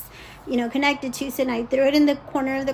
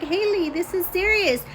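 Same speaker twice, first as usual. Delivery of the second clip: higher-pitched retold appeal; urgent insistence; 6 semitones higher than usual. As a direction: press a daughter to take it seriously. Mother urging her angry daughter.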